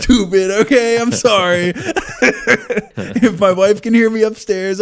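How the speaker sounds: high, loud, laughing through the words; laughing hard. Real, not acted. Teasing Southerners in chat, loud high laughing.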